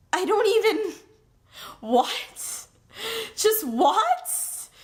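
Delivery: high, baffled "just what?"; a disbelieving cry; stunned disbelief. Real, not acted. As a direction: sound floored by it. Stunned by the book's nerdiness.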